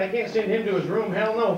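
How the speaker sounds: usual speaking voice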